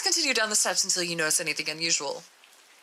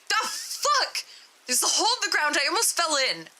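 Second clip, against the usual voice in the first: startled yell; 11 semitones higher than usual. Acted. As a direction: yell it after nearly falling. D-9 nearly falls into a hole.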